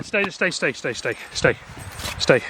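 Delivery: soft, calming repeated stay; soothing. Real, not acted. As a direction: settle the dogs down gently. Calming dogs in trailer.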